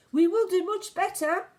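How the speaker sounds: ordinary delivery, nothing marked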